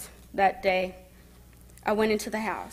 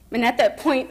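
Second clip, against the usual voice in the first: ordinary talk, nothing marked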